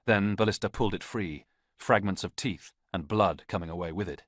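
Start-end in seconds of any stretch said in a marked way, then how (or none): none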